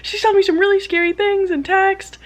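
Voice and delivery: high, worried friend on the phone; imitated freaking out. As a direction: mimic a panicky friend, pitch way up. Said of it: "She was, like, freaking out".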